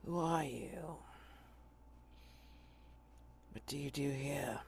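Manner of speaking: put-on faraway old sailor's voice; a hint of a gravelly, distant old lieutenant; low gravelly voice. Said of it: "low, gravelly voice that just seems far away".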